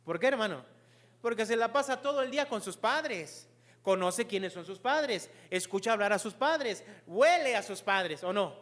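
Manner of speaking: loud, driving sermon delivery; emphatic preaching. Real, not acted. Preacher explaining a child knows his parents, emphatic preaching. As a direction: hammer each point home to the congregation. Preacher drives home the example.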